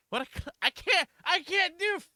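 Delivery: high, loud, helpless protest; exasperated. Real, not acted. Player cannot find a voice for Twink, exasperated. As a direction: give up in high-pitched exasperation. Stuck finding a character's voice.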